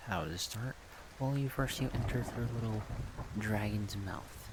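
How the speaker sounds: usual speaking voice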